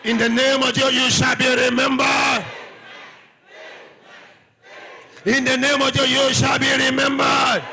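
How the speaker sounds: loud, slow shouted declaration; preaching at full force. Real, not acted. Preacher declaring blessing to congregation, full shout. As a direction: thunder each blessing out over the church.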